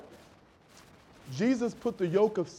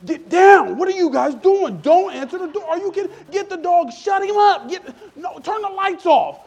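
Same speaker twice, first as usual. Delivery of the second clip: much higher and louder, frantic orders; panicked shouting; 11 semitones higher, 9 dB louder than usual. Retold or imitated. Preacher's family hiding from a door salesman, hammed-up panicked yelling. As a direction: yell in a mock panic to hide the house.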